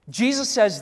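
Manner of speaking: ordinary delivery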